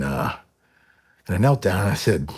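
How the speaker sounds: usual speaking voice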